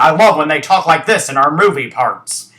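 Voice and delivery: loud, exaggerated American accent; mock American accent. Vlogger mimicking foreign actors in movie parts, hammed-up American accent. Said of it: "when foreigners do, uh, um, American accent".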